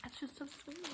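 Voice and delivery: very soft, high, moved murmur; touched, near tears. Real, not acted. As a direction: say it tiny and high, holding back tears. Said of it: "I am gonna cry".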